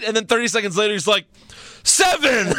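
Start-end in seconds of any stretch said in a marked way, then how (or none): 1.8-2.5 high, drawn-out mock referee count; hammed-up referee call; imitated ref's yell. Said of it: "The ref yells".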